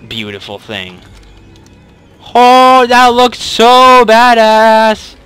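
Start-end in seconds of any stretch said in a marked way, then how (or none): 2.3-5.1 very loud, high, drawn-out excitement; slow, high fanboy holler; thrilled yell. Real, not acted. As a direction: shout it out in awe, stretching the words. Thrilled by new weapon.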